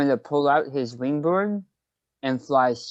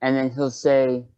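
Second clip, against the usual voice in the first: ordinary talk, nothing marked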